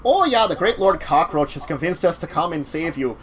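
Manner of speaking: hammed-up Minnesotan cockroach voice; Minnesotan accent. Cockroach man announcing a rescue, Minnesotan accent. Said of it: "I did a really good Michigan accent".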